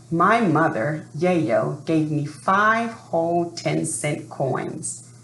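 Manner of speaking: ordinary delivery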